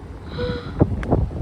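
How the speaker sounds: sharp high gasp of wonder; high awed gasp. Real, not acted. Vlogger sees the Goa view, high awed gasp. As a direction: gasp high, breathless at the beauty.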